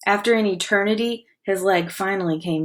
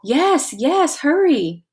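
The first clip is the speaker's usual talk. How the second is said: urgent; 8 semitones higher, 4 dB louder, much slower than usual. Acted. Chet urges Sid to escape.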